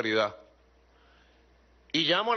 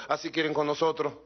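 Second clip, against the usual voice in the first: normal talking voice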